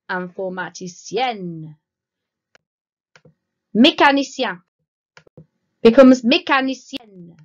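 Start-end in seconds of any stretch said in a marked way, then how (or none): 3.8-7.4 'Mécanicien' then 'mécanicienne' said much louder, -SYAN then -SYEN; loud model pair. Shown as a demonstration. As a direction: contrast the nasal and the open ending. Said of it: "if your job ends in I E N".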